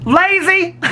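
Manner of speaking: high, loud teasing "Lazy" with a rising end; mock scolding. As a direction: jokingly scold the viewer as a slacker. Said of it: "I'ma yell at everybody".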